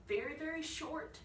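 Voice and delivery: ordinary talk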